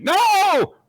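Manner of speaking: high, loud frantic refusal; hammed-up panicked shout; panicked hero. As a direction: squeal it like a scared anime boy. Voicing the hero's panic.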